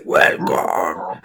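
low, breathy piggy grunt-voice. Retold or imitated. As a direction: say it like a cartoon pig shopkeeper. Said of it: "así con voz de cerdo".

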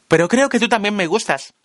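high shouted confession. A narrator's reading. Marla shouts her feelings to the narrator, high. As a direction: call it across the roof. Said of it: "grita Marla".

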